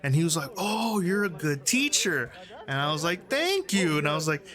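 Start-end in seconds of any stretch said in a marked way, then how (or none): 0.6-2.3 higher, drawn-out mock amazement; delighted praise. Bandmate praising the fan who taught him the H, delighted. 3.3-4.0 high-pitched, pleased retold reply; delighted thanks. Fan answering the bandmate's praise, delighted.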